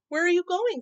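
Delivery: high, loud call across the lot. Acted. Mama calling to old neighbor, loud call. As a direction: call to her from the car. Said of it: "called Mama".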